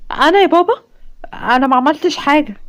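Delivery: lightly voiced girl, a touch high and startled; startled denial. Daughter startled when her father questions her. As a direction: protest innocence, a little rattled.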